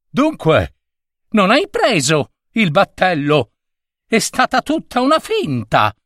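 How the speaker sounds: rising to a high cry of betrayal; shouting. Acted. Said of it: "gridò quel signore".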